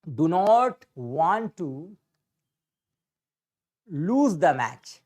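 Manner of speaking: India do not want to lose the match, word by word, very slow; slow dictation pace. Shown as a demonstration. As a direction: spell it out slowly for the class. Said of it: "India".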